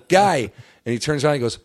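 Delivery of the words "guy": high, loud summons; retold calling out. Fan calls the manager back over, loud retold hail. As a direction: imitate hailing someone for backup.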